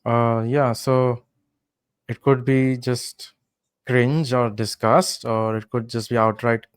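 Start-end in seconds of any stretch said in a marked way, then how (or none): none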